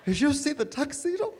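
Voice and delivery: gushing lady at a wedding. Pretend. Lady in the back pews, gushing over the tuxedo. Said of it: "have some lady in the back going".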